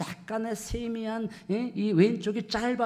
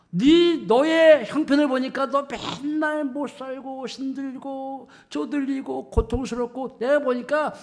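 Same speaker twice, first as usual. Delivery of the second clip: mocking jeer; 6 semitones higher, 6 dB louder than usual. Scoffers telling a churchgoer God is absent, mocking. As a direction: scoff at a believer's hard life. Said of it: "이렇게 나를 향하여 조롱하고".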